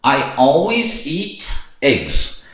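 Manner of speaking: very slow, clear English; slow model answer. Shown as a demonstration. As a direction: model it slowly. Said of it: "Eu sempre como ovos". (resposta muito devagar)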